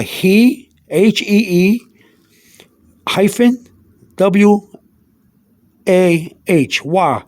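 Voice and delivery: "he" and "wa" apart, each spelled letter by letter with pauses; spelled-out pronunciation. Shown as a demonstration. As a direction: sound out hee, then wah, spelling each slowly. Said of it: "And I put down here".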